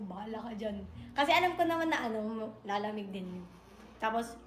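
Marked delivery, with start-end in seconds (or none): 0.0-0.8 soft, as if thinking it; inner thought. Retold or imitated. Thinking to herself about her boyfriend, quiet inner voice. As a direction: say it to yourself under your breath. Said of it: "sa isip ko".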